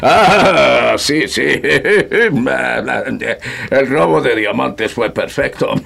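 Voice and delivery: boasting with glee; amused. Acted. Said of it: "sonríe divertido".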